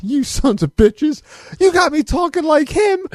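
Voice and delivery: high-pitched mock outburst, climbing sharply; outraged coworker. Coworker turns on pals who got him imitating, high mock outrage. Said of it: "Criff turns around and says".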